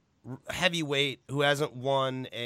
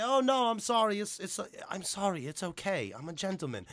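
mock apologetic Regal; 4 semitones higher, 5 dB quieter than usual. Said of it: "look at the ref and say".

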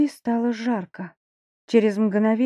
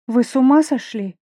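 exclaiming; 3 semitones higher, 6 dB louder than usual. Acted. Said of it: "воскликнула я".

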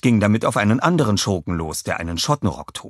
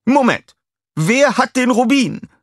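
very high, loud, slower alarmed villainess; a high, loud demand in alarm; startled high call; 6 dB louder than usual. A narrator's reading. Scarlett demands the ruby, high and loud.

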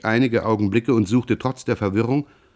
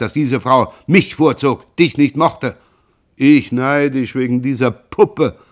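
angry accusation; 3 semitones higher, 4 dB louder than usual. Acted. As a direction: hurl the accusation. Heated quarrel between brothers.

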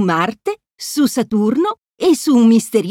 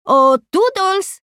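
higher, slow sing-song call; calling Toodles; 8 semitones higher, slower than usual. A narrator's reading. Mickey calls Toodles for help, sing-song call.

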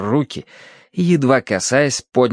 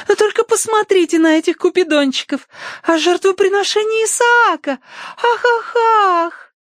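squeaky, very high rapture; Tossie. A narrator's reading. Tossie showing off cupids and Isaac, ecstatic. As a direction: gush on, breaking into delighted ah-ah squeals. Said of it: "восторженные возгласы".

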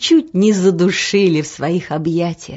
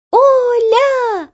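high, drawn-out call; children's shout; 5 dB louder than usual. A narrator's reading. Children calling to their friend, high drawn-out shout. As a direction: call the name out like eager children. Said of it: "закричали дети".

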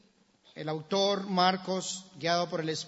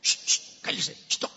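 whispered shushing; hushing rebuke. Acted. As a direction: hush someone sharply under your breath. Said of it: "Y muchos le reprendían". (reprensión susurrada)

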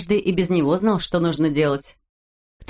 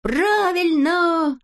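high, drawn-out cry of agreement; long high-pitched cheer; eager shout; 10 semitones higher, much slower than usual. A narrator's reading. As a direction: call out your approval, high and slow. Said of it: "закричала Авоська".

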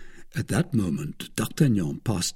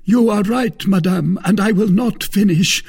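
a wounded noble's raised complaint; Porthos voiced high and loud; 11 semitones higher, 6 dB louder than usual. A narrator's reading. Porthos reproaching the wife, loud and high. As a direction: lay the guilt on thick, pitched high.

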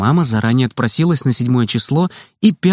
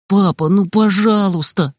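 pleading; 5 semitones higher than usual. A narrator's reading. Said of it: "взмолился я".

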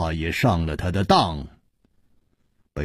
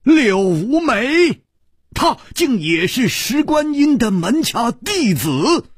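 much higher, louder stunned echo; shocked disbelief; 5 dB louder than usual. Acted. Liu Wumei repeats the revelation in stunned, high disbelief. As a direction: gasp the words back in disbelief.

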